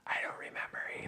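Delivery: hushed whisper. Real, not acted. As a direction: whisper it softly. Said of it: "Why are we whispering?".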